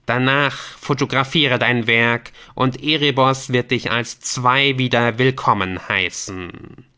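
commanding game messenger. Acted. Messenger gives Squamanto his task in the cave, commanding. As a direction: issue the orders solemnly and firmly.

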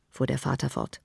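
plain reading voice

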